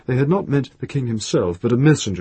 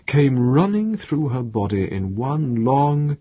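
usual speaking voice

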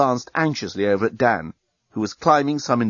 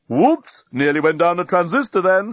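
startled; 6 semitones higher, 3 dB louder than usual. A narrator's reading. Dan in VR.

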